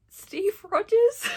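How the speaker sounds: high, loud, slow gleeful shout; gleeful shout of the name; excited exclamation. Real, not acted. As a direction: yell the reference in delight. Shouting Marvel joke.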